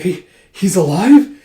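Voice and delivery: stammered, high, loud question; shocked disbelief. Acted. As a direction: blurt it out in shock. Soldiers shocked he lives.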